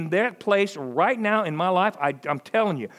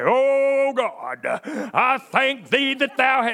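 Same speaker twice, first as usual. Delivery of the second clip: hammed-up churchy prayer voice, louder and slow; mock formal prayer; 6 semitones higher, 3 dB louder, much slower than usual. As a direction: pray in pompous old-fashioned style. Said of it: "Well, let's pray".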